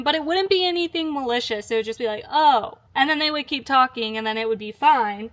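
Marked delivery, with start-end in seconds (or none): 2.3-2.7 a short, louder "Oh." of mild surprise; the surprise of hearing someone is American; flat surprised oh. Retold or imitated. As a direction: drop a little deflated "Oh.". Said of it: "they would change in their voice".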